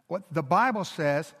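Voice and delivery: ordinary talk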